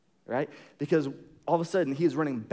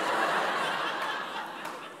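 breathy laugh; 3 dB quieter than usual. Real, not acted. Laughs at the pretence.

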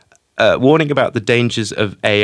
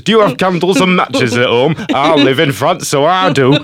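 a hammed-up, laughing Yorkshire accent, higher and louder; 6 semitones higher, 4 dB louder than usual. Host rereads Ridley's quote to a co-host, in mock Yorkshire accent. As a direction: do Ridley Scott in a broad Yorkshire accent, grinning. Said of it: "can we not hear it in the accent, in the Yorkshire accent?".

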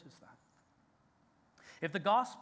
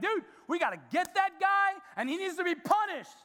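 much higher, louder outraged David, half-mocking; 13 semitones higher, 7 dB louder than usual. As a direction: blurt it out like an indignant king. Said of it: "He's like".

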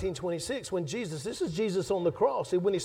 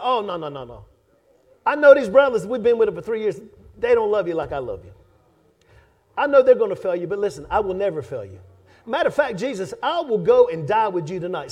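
boastful Peter; 8 dB louder than usual. Acted. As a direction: brag like a mouthy disciple who swears he'll never fail. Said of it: "Boastful, arrogant, mouthy Peter".

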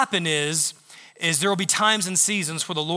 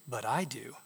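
softer, lower gentle answer; God's tender reply; 6 semitones lower, 11 dB quieter than usual. Retold or imitated. God answers the doubting giver, soft and low. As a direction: let it land softly and low. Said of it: "He goes".

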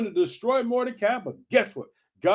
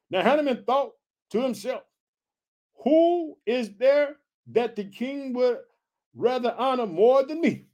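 slow, high-pitched Bible reading; scripture reading; 5 semitones higher than usual. A narrator's reading. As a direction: stretch out the verse for the congregation. Reads scripture aloud.

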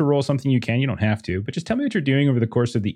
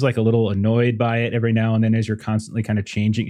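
ordinary talk, nothing marked

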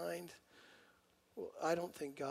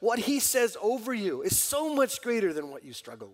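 loud, driving sermon peak; preaching at full force; 5 semitones higher, 8 dB louder than usual. Acted. Preacher urging believers not to be defined by failure, loud and driving. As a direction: press the point home to the congregation.

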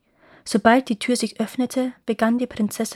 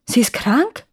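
concerned question, rising sharply at the end; a touch of worry, voice lifting; worried question; 3 semitones higher, 4 dB louder than usual. A narrator's reading. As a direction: ask with mild alarm. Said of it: "fragte der Prinz besorgt".

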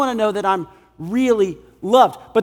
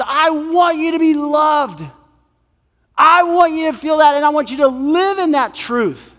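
high, forceful proclamation; impassioned declaration; 6 semitones higher than usual. Acted. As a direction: proclaim it with raised pitch and fervour. Voices God's emphatic declaration.

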